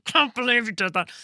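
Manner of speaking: mock-furious scolding from a husband; angry husband. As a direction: imitate an appalled, angry partner. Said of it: "he was so angry".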